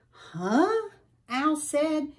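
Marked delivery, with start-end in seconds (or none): 0.1-0.9 confused huh. A narrator's reading. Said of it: "He was confused".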